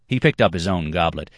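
plain reading voice

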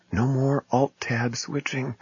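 soft, slow, awestruck hush; awed hush. Pretend. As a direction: breathe it in wonder. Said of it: "Think of it".

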